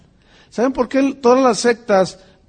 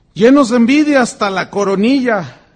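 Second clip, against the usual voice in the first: louder, hammering declaration; forceful preaching; 3 semitones higher, 7 dB louder than usual. Real, not acted. Preacher denouncing envy to the congregation, forceful. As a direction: pound the words home.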